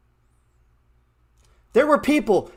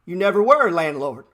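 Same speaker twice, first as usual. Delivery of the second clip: ordinary talk, nothing marked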